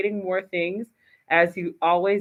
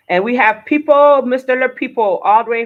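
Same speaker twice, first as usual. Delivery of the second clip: excited shout-out; 4 semitones higher, 11 dB louder than usual. Real, not acted. Host excitedly greets live listeners.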